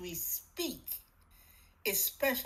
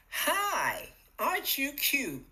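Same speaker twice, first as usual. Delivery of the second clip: higher, louder, delighted sing-song greeting; cheerful coo; 7 semitones higher, 3 dB louder, slower than usual. Shown as a demonstration. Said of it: "Can you hear the difference?".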